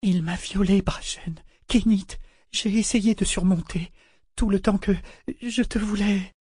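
very high, cracking, tearful confession; broken voice. A narrator's reading. Woman confessing her trauma to her lover, voice breaking. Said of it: "dit-elle d'une voix brisée".

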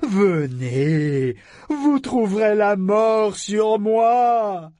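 very slow, loud, high crazed figurehead voice; savage mad taunt. Acted. The figurehead taunting the boarders, savage crazed taunt. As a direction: lure them in like a mad ship spirit. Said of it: "éclata d'un rire sauvage".

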